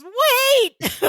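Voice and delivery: high, urgent mock cry to stop; sudden high-pitched retold yelp; mock scream. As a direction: imitate screaming for someone to stop. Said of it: "And so he screams".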